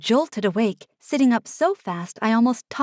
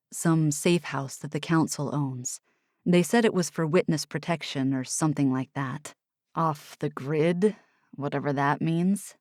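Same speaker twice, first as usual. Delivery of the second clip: low voiced young man, tired; Oliver, low and weary; 5 semitones lower than usual. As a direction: drop low for Oliver, matter of fact. Oliver's lines in narration.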